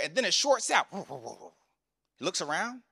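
normal talking voice

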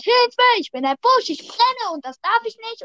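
high squeaky mock cry for help; burning bush screaming. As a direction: play a tiny panicked bush on fire. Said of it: "dass der Busch brennt und schreit".

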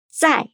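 sharp falling tone; 在 as zài, high falling fourth tone; Mandarin zài. Shown as a demonstration.

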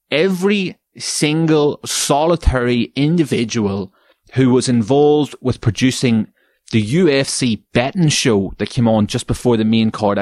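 ordinary talk, nothing marked

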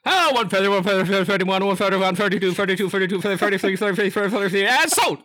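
loud, high, rapid-fire auctioneer chant in English. Pretend. A friend plays a cowboy auctioneer selling cars, loud and high. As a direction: rattle off bids like a Texan auctioneer. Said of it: "und dabei rumgeschrien".

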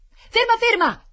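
high, very loud shout to stop; urgent shout. A narrator's reading. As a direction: call frantically after a runaway. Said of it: "gridò il signore".

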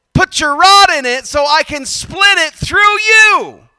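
loud, high, ringing preacher's cry; preaching at full force. Acted. Pastor rallying the congregation, full-force preaching. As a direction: lift it up and drive it home to the church.

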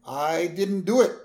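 hint of a shopkeeper's excuse; Apu voice. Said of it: "Apu's like".

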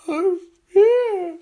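put-on very high, tiny child voice; little kid voice. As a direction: squeak it like a child. Voicing Hal's brother's kids.

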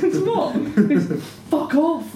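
high, loud retort with laughter; hammed-up, drawn-out dismissal; mock angry dismissal. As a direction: act out a row while cracking up. Acted quarrel from the show.

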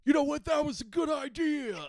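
high, loud hammed-up outburst; Nick Cage. Playing the hero's sudden realization, hammed-up shout. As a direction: blurt it out like a manic movie star. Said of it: "Nick Cage is like".

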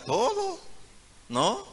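very high falsetto squeak; squeaky high aside. Real, not acted. Pastor's squeaky aside to mothers. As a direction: pop up into falsetto.

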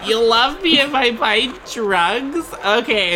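high and slow, lovestruck; a lightly voiced girl who falls for it; a hint of a naive girl; smitten girl.

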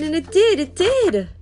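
high, loud lament; distressed exclamation. A narrator's reading. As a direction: wail it out in acted dismay. Boy laments fish disaster.

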